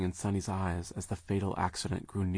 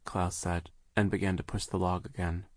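usual speaking voice

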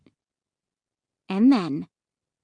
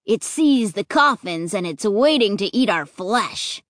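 usual speaking voice

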